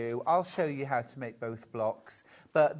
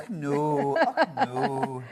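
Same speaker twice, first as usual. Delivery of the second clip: put-on Scottish accent; 6 dB louder, much slower than usual. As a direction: answer in a jokey Scottish accent. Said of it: "Was that your Scottish accent?".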